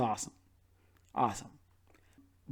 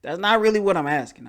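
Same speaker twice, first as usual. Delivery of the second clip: retold protest; 5 semitones higher, 6 dB louder, faster than usual. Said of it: "And I was just like".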